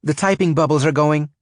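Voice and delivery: high loud excited outburst; excited cry. Acted. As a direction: call it out, thrilled and urgent. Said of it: "she cried out".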